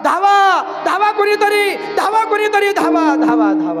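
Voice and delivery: high, loud desperate shout; loud cry for help. Acted. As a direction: yell for help as if collapsing. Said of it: "मोठ्यानं ओरडू लागला". (मोठ्याने मदतीसाठी आरडाओरडा)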